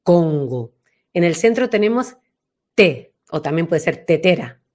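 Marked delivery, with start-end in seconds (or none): 0.0-0.7 "Congo" lower and from behind the palate; back resonance. Shown as a demonstration. Teacher naming the mountain station, dark "Congo". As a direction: let "Congo" sit at the back. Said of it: "ahí detrás por el paladar".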